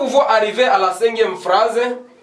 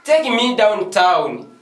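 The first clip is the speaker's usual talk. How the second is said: slow, drawn-out model phrase; slow demo; 3 semitones higher, much slower than usual. Shown as a demonstration. Teacher modelling a phrase slowly for students. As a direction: spell it out slowly for the class. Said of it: "you can say".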